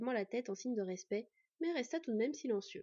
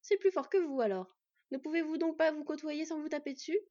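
scolding; 5 semitones higher, 5 dB louder than usual. Acted. Fen scolding the brothers.

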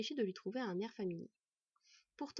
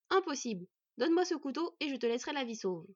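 louder, higher, insistent threat; forceful demand; 5 semitones higher, 5 dB louder than usual. A narrator's reading. Elf threatens the boy for the knife, forcefully. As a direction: press the demand hard.

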